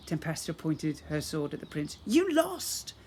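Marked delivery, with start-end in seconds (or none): 2.1-2.9 triumphant shout. Acted. Tempesta triumphant.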